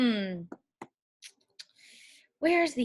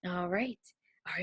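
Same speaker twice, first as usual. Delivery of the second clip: whispering; 4 semitones lower, 10 dB quieter than usual. Real, not acted. Teacher aside.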